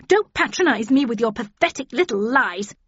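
scornful outburst, rising to a high pitch; spitting, voice rising. A narrator's reading. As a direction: start indignant and climb to a loud accusation. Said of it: "her voice rising".

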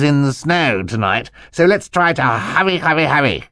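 high, excitable character voice; wound-up uncle. Acted. Uncle hurries three dawdling children to work, wound up. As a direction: chivvy them along in a high, animated uncle voice. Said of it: "he seemed so wound up".

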